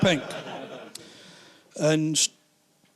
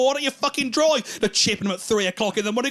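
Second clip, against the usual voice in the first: high, mocking dare; sarcastic jab; 7 semitones higher than usual. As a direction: girlfriend taunting him to go. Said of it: "And she'll go".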